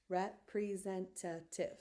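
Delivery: representative slowly split into five separate beats; syllable by syllable. Shown as a demonstration. Teacher checking the syllable count, slow separated word. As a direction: recount "Representative" slowly, a beat per syllable. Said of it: "five syllables".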